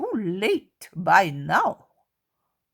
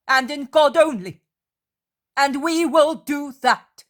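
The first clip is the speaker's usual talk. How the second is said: loud, higher, firm declaration; thundering voice; 6 semitones higher, 6 dB louder than usual. A narrator's reading. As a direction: boom it out to silence them. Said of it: "in a thundering voice".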